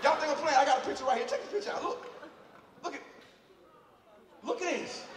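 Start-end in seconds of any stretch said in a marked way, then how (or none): 1.3-5.0 breathless laugh through the words; can't hold it together, laughing. Real, not acted. Showing the crowd the eyebrow photo, laughing.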